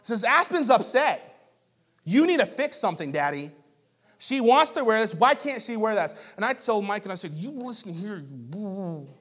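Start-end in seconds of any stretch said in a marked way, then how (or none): 0.2-6.1 put-on high, loud, indignant child taking up a sibling's cause; Micah. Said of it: "Micah turns to us like". 7.3-9.1 mock threat. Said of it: "No, I don't do that".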